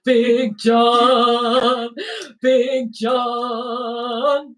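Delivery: loud, booming call of the name. Pretend. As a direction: chant his name like a crowd. Chanting the name loudly.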